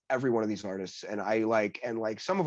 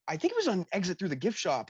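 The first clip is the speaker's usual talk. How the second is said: normal talking voice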